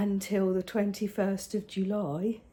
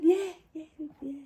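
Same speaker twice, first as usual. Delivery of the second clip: put-on child voice; 8 semitones higher than usual. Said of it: "You all right, darling?".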